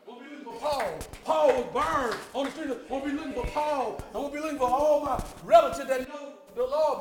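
loud, rising preacher cadence; fiery preaching. Acted. Preacher telling the congregation who he'll seek in heaven, preaching hard. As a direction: preach it to the back pew.

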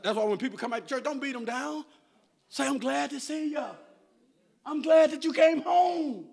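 full-throated gospel preaching; preaching at full force. Acted. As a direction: whip the congregation up with the message. Sustained loud sermon climax.

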